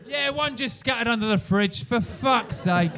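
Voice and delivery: slow, loud, fed-up curse; hammed-up weary moan; exasperated flatmate. Flatmate answering about the seal, slow and loud.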